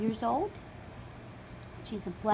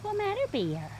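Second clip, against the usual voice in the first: pet voice; 10 semitones higher than usual. Real, not acted. Asking dog what's wrong.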